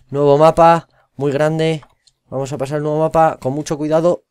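loud, high hype for the new map; hyped announcement. Real, not acted. Streamer to viewers, presenting a new map with loud, high hype. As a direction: announce it like a show opener.

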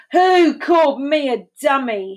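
loud, high-pitched challenge; indignant demand. A narrator's reading. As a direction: demand it, offended and forceful. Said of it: "he demanded".

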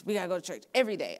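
quick, mock-nagging mother; nagging mom. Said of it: "always be on us".

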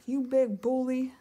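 loud teasing jeer; taunting. Acted. Flounder taunting the caught shark, loud and cheeky. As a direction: stick your tongue out with it.

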